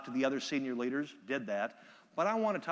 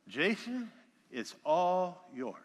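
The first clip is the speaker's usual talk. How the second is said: slow, drawn-out slurry introduction; a mildly mocked drunk handing over the stage; a touch of a plastered host; 3 semitones higher, much slower than usual. Speaker imitating the drunk host's introduction, slurred. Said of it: "the best he can muster is".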